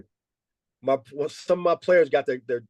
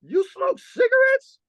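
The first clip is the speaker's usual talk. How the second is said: much higher, slow mock-outraged question; upset disbelief; 4 dB louder than usual. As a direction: stretch it out in shocked disbelief. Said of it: "So now I'm gonna get upset".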